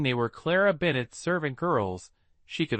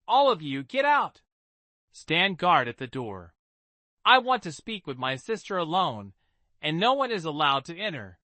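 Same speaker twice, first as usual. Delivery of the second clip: put-on commanding young mistress; 3 semitones higher than usual. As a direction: order the servants out. Clara ordering servants out.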